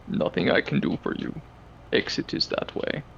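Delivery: whispery character voice, barely voiced; whispered. Player answering about the injured goblins in character, whispered. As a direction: deliver it in a hushed whisper.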